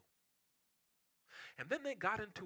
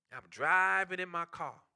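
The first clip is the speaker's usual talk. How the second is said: ordinary delivery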